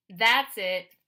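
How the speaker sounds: sudden loud snap; loud outburst. A narrator's reading. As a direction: burst out loud, fed up. Kid snaps at bully on bus.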